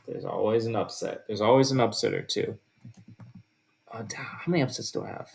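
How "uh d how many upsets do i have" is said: whispered question to himself, off-mic; whispered aside. Real, not acted. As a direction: whisper it under your breath as you check your notes. Muttering to himself over his list.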